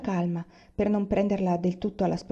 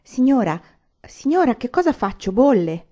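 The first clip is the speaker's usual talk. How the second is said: loud, high alarmed call from the doorway; anxious calling out; 4 semitones higher, 8 dB louder than usual. Acted. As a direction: shout for the mistress in a fluster. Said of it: "comparve all'uscio un po' inquieta".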